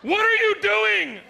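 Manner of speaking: high, loud, drawn-out demand; shrill-pitched angry shout; mad wife yelling. Acted. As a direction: scold at full volume like a furious wife. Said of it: "She gets mad at you".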